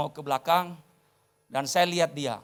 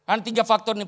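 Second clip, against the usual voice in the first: usual speaking voice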